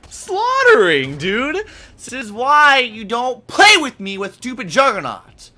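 high, loud victory yell; fired-up gamer trash talk; gloating shout. Real, not acted. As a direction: shout it in triumph at beaten opponents. Gloating mid-game over kills.

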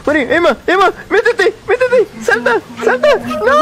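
high rising frantic calls; frantic urging. Real, not acted. Urging teammate in game.

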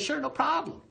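a hint of a high, easygoing turtle; lightly voiced turtle. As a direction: shrug it off like a confident turtle. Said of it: "And then the turtle said".

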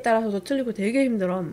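talking while dabbing lips; slightly covered mouth; speaking with tissue at mouth. Real, not acted. Seen 1: Tissue held at or in front of mouth from 0.9 to 3.1s.

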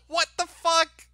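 incredulous. Real, not acted. Streamer stunned by the clip, incredulous. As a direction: blurt it out in amused shock. Laughing disbelief.

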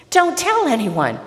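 high, loud call. Acted. Jesus warns the departing man, calling out. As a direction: call the instruction after someone leaving. Jesus calls after the runner.

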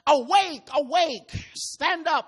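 loud, high call to awake; preacher crying awake. Acted. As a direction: rouse them with a ringing cry. Preacher calls out the verse.

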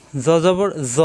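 জ said thick, tongue tip at the teeth; thickened, buzzing জ; heavy consonant. Shown as a demonstration. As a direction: make জ full and heavy. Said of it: "জিব্বার আগে দাঁতের গায়ে কিন্তু মোটা হবে".

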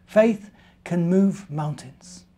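slow, measured and tender; slow wise moral. Acted. Mother Bear giving Benjamin the moral, slow tender voice.

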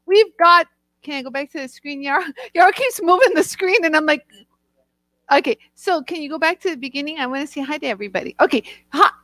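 usual speaking voice

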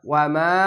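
"ওয়ামা" with final মা held long; recited "ওয়ামা", drawn-out মা; one-alif elongation. Shown as a demonstration. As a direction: lengthen the last vowel of ওয়ামা. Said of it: "এক আলিফ টেনে পড়বেন".

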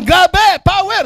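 high hype cries; shouting. Acted. Recruit yelling slogans at nobody, shouting. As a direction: yell the slogans like a fanatic. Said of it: "Sumisigaw kahit wala".

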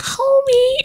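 very high, stretched-out "Homey" in an imitating cartoon voice; Marge Simpson impression. Quoting Marge to viewers, a very high mock "Homey". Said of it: "Marge is like".

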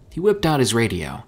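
ordinary delivery, nothing marked

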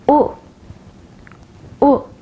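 short "U" as in book; quick, isolated "U", the vowel of could; short vowel demo. Shown as a demonstration. Teacher showing learners the short vowels, "U" said short. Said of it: "Take a look at the short vowels".

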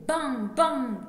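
two loud bangs, each falling; imitated gunshots. Poet voicing cowboys shooting from horseback, loud mock gunfire. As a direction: fire the bangs off like shots.

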